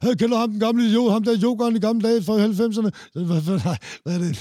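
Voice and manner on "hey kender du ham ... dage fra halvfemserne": raised, fast, scornful mock voice; mocking retort. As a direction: hurl it back at the woman, mocking and quick. Retold quarrel at Nørreport station. (hånligt, hurtigt og lyst)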